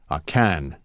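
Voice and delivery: plain reading voice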